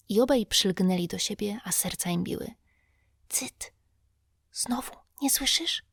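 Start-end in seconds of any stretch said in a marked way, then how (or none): 3.3-5.8 voiceless hushed hiss, higher; urgent whisper, don't be heard; frightened whisper. A narrator's reading. Tom warns Huck of a sound in the dark, frightened whisper. Boys hiding in graveyard.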